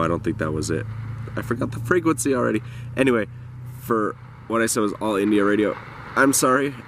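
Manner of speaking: usual speaking voice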